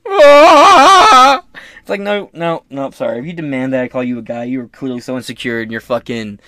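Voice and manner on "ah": loud, high drawn-out cry; hammed-up high yell; mock wail. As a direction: cry out in exaggerated protest. Climax of the mocking caricature.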